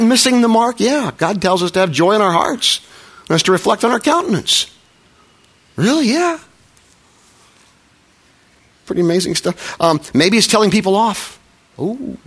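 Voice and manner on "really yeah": mock surprise. Mock exchange, high rising.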